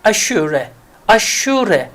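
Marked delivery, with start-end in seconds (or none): none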